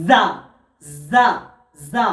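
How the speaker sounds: জা with teeth closed, tongue snapped; careful letter drill; Arabic letter demo. Shown as a demonstration. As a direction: repeat জা clearly. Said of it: "জা উচ্চারণ হয়".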